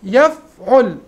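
"يفعل" split as yaf... ul; pattern breakdown. Shown as a demonstration. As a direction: pause after the f in "يفعل", then 'ul. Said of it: "على وزن ماذا".

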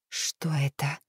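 hushed, breathless question; a frightened whisper at the dripping walls. A narrator's reading. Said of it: "прошептала я".

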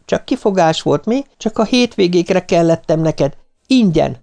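high-pitched, indignant reproach; heated accusation. A narrator's reading. Nancy quarrels with Martin, high indignant reproach.

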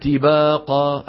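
"طباقة" with a light ta like ta marbuta, slow; thin the ta in "طباقة"; thinned ta. Shown as a demonstration. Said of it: "بدل ما يقول طباقة". (ترقيق الطاء خطأ)

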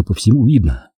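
ordinary delivery, nothing marked

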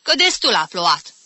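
loud irritated grumble; scolding, shooing. Acted. Aunt chasing off the cat blamed for rain, scolding. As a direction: finish the telling-off loudly. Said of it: "o izgoni Magdalena".

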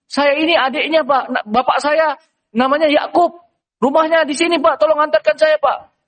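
high, pleading voice; pleading Yusuf. Acted. Young Yusuf begs the trader to take him home, high pleading. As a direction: beg to be taken home, pitched high.

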